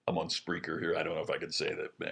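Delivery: low, soft aside under the breath; quiet aside. Real, not acted. Storyteller breaking off a rude word for the broadcast, quiet aside. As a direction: drop well down in pitch and volume.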